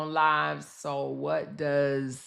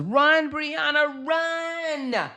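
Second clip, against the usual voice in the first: mock panicked shout, much higher than her usual voice; retold scream; 9 semitones higher, much slower than usual. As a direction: yell it like she's screaming for the girl to run. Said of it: "She says she was screaming for Brianna to run".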